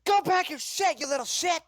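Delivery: high, loud angry-mother yell; shrieky parental outburst; yelling at child. Acted. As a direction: snap furiously at the kid. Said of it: "You're yelling at a child".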